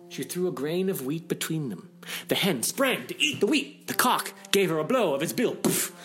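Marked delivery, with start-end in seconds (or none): none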